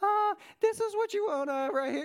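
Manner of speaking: high-pitched, hammed-up nervous stammer; super nervous. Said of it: "I'm super nervous".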